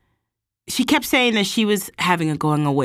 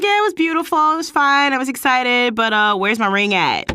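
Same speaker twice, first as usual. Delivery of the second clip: mock cheerful reply; 8 semitones higher, 3 dB louder than usual. Her mock reply to friends.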